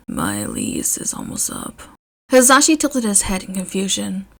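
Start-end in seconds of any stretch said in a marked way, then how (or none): 0.1-1.8 much lower and softer, embarrassed; very low, soft grumble; Shota's deep quiet voice. A narrator's reading. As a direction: mumble it, a little ashamed.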